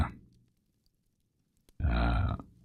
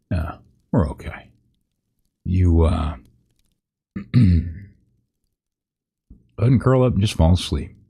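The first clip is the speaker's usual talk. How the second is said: usual speaking voice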